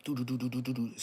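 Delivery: bouncy step noises; footstep sounds. Retold or imitated. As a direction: mimic heavy walking beats. Imitating her footsteps.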